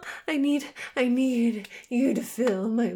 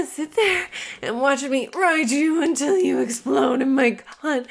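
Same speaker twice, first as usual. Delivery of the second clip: ordinary talk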